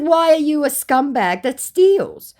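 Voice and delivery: high, slow, scornful question; snooty high voice. Retold or imitated. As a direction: demand it with lofty scorn. Her own retort, acted.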